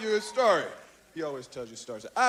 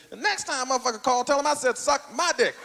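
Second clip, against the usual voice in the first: quick, irritated phone-advice impersonation; Richard Pryor; 2 semitones lower than usual. Acted. Pryor advising the comic about Cosby's call, brisk impersonation. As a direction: toss off the profane advice as an impression. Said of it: "And Richard said".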